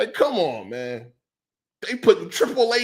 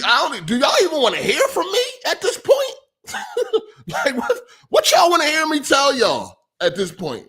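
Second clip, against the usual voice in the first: louder and higher, real frustration breaking through; real exasperation, loud and pitched up; exasperated outburst; 9 semitones higher, 5 dB louder than usual. Real, not acted. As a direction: throw the question at them, fed up and half laughing. Venting frustration at his audience.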